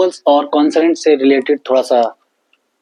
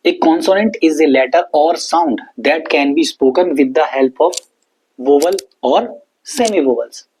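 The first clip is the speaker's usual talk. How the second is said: slow, careful definition read word by word; slow dictation; much slower than usual. Shown as a demonstration. Teacher dictating the consonant definition to students, slowly. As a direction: dictate it so pupils can write it down. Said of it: "अगर इसकी definitions की बात करूं".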